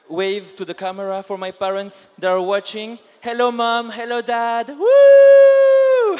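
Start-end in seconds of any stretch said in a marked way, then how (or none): 3.2-4.7 excited greeting. Real, not acted. Waving to parents on camera. 4.7-6.2 a loud, high whoop of joy; whooping cheer. Real, not acted. Waving to her parents watching, a loud happy whoop.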